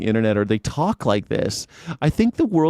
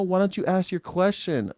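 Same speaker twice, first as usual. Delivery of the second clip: ordinary delivery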